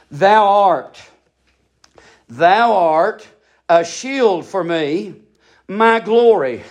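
low, measured prayer voice, each phrase set apart. Acted. Quiet meditative scripture recitation.

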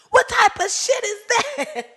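slow, high, laughing through the words; laughing disbelief. Real, not acted. Host reacts to someone asking for her car, laughing.

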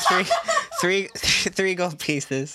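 laughing tease. Retold or imitated. Player teasing game master about accent, laughing. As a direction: repeat the catchphrase, laughing.

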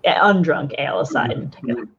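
usual speaking voice

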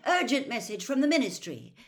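harassed, urgent summons; calling upstairs. A narrator's reading. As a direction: call the message up to him. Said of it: "she called up the staircase".

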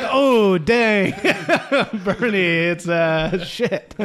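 amused "oh dang" reaction; laughing mock dismay. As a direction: act out awkward discovery while cracking up. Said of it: "he's like".

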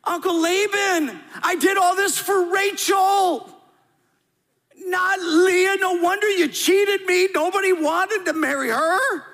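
high, loud, drawn-out whiny protest to uncle Laban. Acted. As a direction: complain like a cheated child. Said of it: "in a whiny voice".

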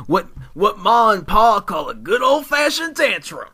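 put-on loud, layered British-Southern accent; British doing Southern accent. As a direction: play a Brit hamming up a Southern accent. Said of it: "I was actually doing a British accent, doing a Southern accent".

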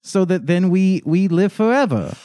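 put-on sing-song creepy cult kid. Creepy child describing ritual.

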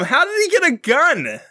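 high-pitched, baffled outcry; incredulous. Real, not acted. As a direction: demand it in real disbelief. Host incredulous at comic's plot.

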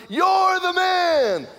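high, loud, slow chant; team shouting. Retold or imitated. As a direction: shout it back like a whole team. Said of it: "my team starts yelling".

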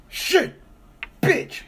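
high yelled curse, mock outburst; mock yell of a frustrated stranger; imitated angry yell. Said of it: "all you hear is".

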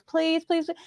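high, quick retold begging; retold pleading. Client begging the caller for a country accent, retold pleading. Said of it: "She goes".

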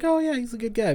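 high-pitched, light mock approval; acted pleasant realization, pitched high; mimicked approval. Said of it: "where it's like".

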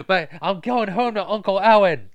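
high, loud mock whine; whiny kid riff. Riffing on the bullied kid as Luke, high mock whine. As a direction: riff as the whiny farm boy.

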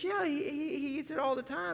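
high-pitched comic insistence; defensive chicken. Acted. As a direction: lightly play the chicken justifying the plan. Chicken defends plan to pig.

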